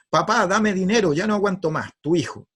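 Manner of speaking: brisk, high, demanding read-out; angry letter reading. Acted. Father reading son's letter to his wife, angry. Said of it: "si me lo hubiese pedido así".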